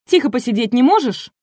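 высокий громкий упрёк; одёрнуть сердито, повысив голос; раздражённо. A narrator's reading. Энн набрасывается на рассказчицу. (сердитый окрик на высоких нотах)

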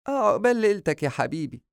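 high-pitched motherly well-wishing; fond mother's high voice; doting mother. A narrator's reading. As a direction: wish your son joy in a lifted voice. Mother blesses her son.

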